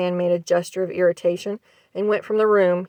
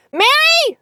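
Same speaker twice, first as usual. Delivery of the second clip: loud, high call; said loudly; 6 dB louder than usual. A narrator's reading. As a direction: call her name sharply to test her hearing. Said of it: "Then he said loudly".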